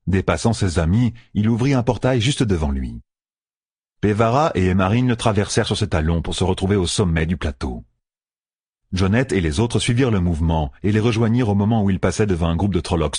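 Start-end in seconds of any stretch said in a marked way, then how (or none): none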